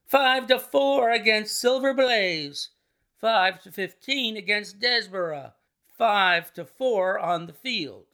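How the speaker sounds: high, loud odds-calling, easing towards the end; roaring bookmaker. A narrator's reading. Bookmakers in the ring offering odds on horses, roaring. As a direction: call out the betting over the crowd. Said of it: "roared the ring".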